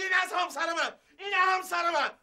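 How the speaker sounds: high, shouted, still in panic; panicked cry. Acted. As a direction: yell it out in alarm. Panicked at seeing his wife. (فریاد هراسان)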